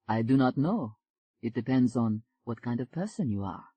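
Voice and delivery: a smooth Frenchman greeting her; French accent. Acted. Jean-Claude answers.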